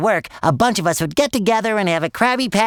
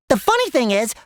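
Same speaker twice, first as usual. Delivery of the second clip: ordinary talk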